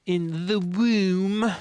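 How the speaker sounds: high, very drawn-out mimicry; mocking echo. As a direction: stretch it out high, mimicking grandly. Echoing game character's line.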